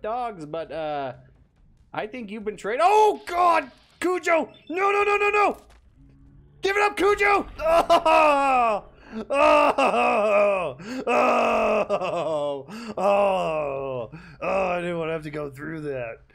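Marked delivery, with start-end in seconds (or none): 2.8-7.5 high panicked yelling; panicked screaming. Real, not acted. Dog attacking. 7.6-16.2 loud anguished cries; anguished wailing. Real, not acted. Crying out after attack.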